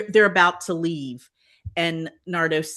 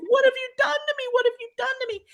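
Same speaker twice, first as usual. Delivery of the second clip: mock scream; much faster than usual. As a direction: squeal it in mock horror at the hairdo. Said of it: "she screams when she sees it".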